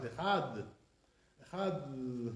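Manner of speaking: "Echad" low, ending in a soft th as in "the"; "Echad" said quietly with no plosive at the end; soft Dalet. Shown as a demonstration. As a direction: soften the final d of "Echad" into a lingering dh. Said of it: "soft Dalet is like a T H sound".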